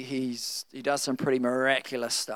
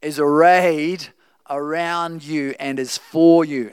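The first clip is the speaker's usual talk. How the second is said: slow, louder preaching build; preaching at full force; 6 dB louder, slower than usual. Acted. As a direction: proclaim God's backing to the congregation.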